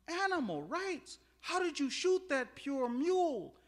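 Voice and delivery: high, drawn-out indignant protest; mock outrage. Husband scolding wife for shooting the mule, outraged. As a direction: protest slowly at high pitch, scandalized.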